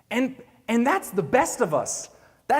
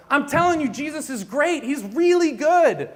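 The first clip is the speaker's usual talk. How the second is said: higher, pushy mock enthusiasm, stretching out really good; overeager pitch; 6 semitones higher, 4 dB louder than usual. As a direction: oversell it like a pushy salesman. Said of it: "we're like".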